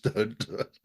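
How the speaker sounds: ordinary talk, nothing marked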